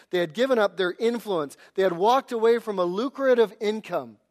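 loud, driving sermon delivery; forceful preaching. Acted. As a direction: preach it hard to the congregation.

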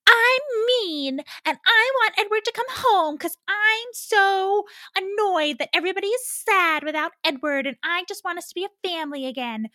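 high-pitched mocking impression of a mean character; exaggerated mean whine; mean-girl voice. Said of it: "why is that said in a mean voice".

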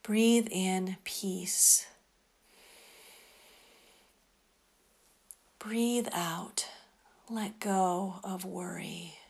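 softly whispered, slow breath prayer; whispering. Acted.

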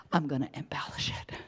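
whispered, quick and secret; confiding whisper. Acted. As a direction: lean in and whisper it fast. God voiced, confiding aside.

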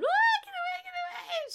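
high, loud frantic crying plea; panicked plea. Retold or imitated. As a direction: beg it to get back, half sobbing. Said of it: "too panicked to do anything".

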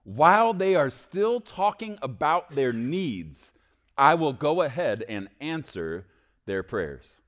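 slow, loud and high, stretched out; proclaiming scripture. Acted. Preacher reading God's promise to the church, slow and loud. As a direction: lift the promise up slowly.

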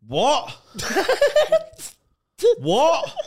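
high, loud laughing what; laughing disbelief. Real, not acted. Asked about yoga mid-hangover, high laughing disbelief.

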